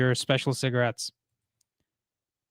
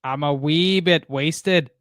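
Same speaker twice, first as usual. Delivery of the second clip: slow, drawn-out Scottish accent; amateur Scots impression, taken slowly; attempted Scottish accent; much slower than usual. Shown as a demonstration. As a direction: have a go at Scottish, stretching the words. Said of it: "trying to do my best Scottish accent".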